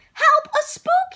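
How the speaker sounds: very high, loud, slow scared cry; frightened yell. Acted. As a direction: shriek for help at a spook. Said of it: "yelled the princess".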